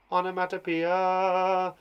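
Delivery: Onomatopoeia said slowly, every syllable stretched; drawn out. Shown as a demonstration. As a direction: spell out Onomatopoeia slowly for the class. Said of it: "It's that onomatopoeia".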